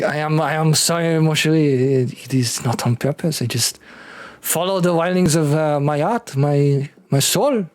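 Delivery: put-on halting French-accented apology, lifting at the end; apologetic French husband. Husband apologizing to his wife after the slap, French accent. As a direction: plead romantically with a French accent.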